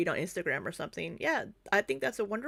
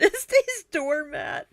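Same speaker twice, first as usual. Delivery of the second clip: high, loud and slow through laughter; helpless laughing; 11 semitones higher, 5 dB louder than usual. Real, not acted. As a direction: squeal it out, nearly crying laughing. Repeating the slip, cracking up.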